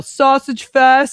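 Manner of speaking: drawn-out mock yell, slow. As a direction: call it out slowly like taunting troops. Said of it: "all the other troops would yell".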